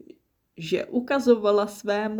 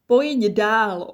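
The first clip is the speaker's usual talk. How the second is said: louder call from the water, pitched up; 4 semitones higher, 7 dB louder than usual. Acted. Voice in the river calling Snappy in. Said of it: "ozval se hlas z vody".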